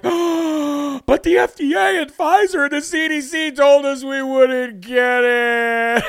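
high, slow, mock-shocked whine; hammed-up disbelieving whine; mocking whiny quote. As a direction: play the panicked vaxxer, dragging the words out.

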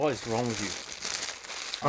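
words round a sour sweet; eating sour candy. Real, not acted. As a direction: talk with candy in the mouth. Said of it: "put it back in your mouth".

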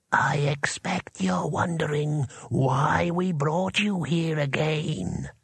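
slow, breathy voice by the ear; whispery spell voice. A narrator's reading. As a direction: whisper it slowly, drawn out and eerie. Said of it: "said a voice by his ear".

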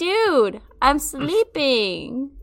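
high, loud, slow annoyed retort; annoyed reply. Retold or imitated. To the late texter, fed up slow retort. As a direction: drag it out, fed up. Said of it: "And I'm like".

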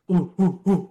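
loud, high ape-like "ooh, ooh"; imitated chest-beating hoots. Mocking rowdy soldiers at the bar, loud hooting. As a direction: hoot loudly like chest-beating brutes. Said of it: "fucking beating on their chest".

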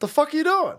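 high baffled gruff grandpa; a hint of a startled grandpa; mock grandpa. Grandpa seeing the kid baking, high baffled yell. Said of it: "my grandpa goes".

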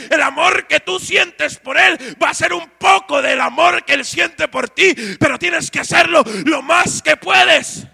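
loud, fervent shouted preaching; a preacher shouting about tithes and God's love; preaching at full force. Acted. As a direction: thunder it at the congregation.

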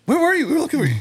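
high, loud startled cry of relief; buddy at the door, shocked to see him, surprised cry. Retold or imitated. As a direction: call out like you found a lost friend.

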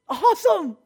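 high-pitched, delighted exclamation; excited echo. Retold or imitated. Subway stranger answering the preacher's enthusiasm, excited. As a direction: imitate a thrilled stranger.

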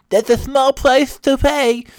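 high, slow whine with tongue hanging out; tongue-out talk. Acted. Husband defending himself to wife, high tongue-out whine. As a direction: whine thickly around a stuck-out tongue. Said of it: "I just talked like that".

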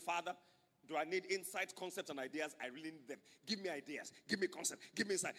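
a hint of an earnest prayer on one's knees; lightly voiced prayer. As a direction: plead quietly to God. Colleague praying on knees.

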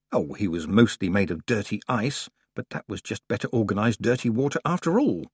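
ordinary delivery

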